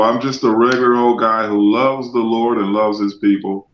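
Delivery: ordinary talk, nothing marked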